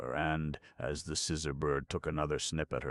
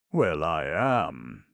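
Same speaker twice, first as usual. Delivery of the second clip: defiant; 2 semitones higher, 8 dB louder, much slower than usual. A narrator's reading. Scraps interrupts defiantly.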